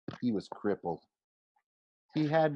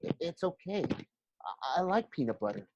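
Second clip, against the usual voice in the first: put-on higher, softer, stammering boy; nervous Grover; 7 semitones higher, 5 dB quieter than usual. A narrator's reading. Grover calming his friend, higher and soft. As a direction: soothe him while flinching. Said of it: "Grover tried to calm me down".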